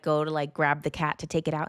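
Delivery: ordinary talk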